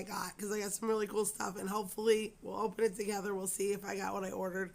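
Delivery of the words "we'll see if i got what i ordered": ordinary delivery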